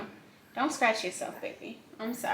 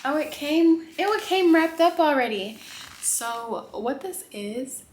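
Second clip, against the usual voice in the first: ordinary delivery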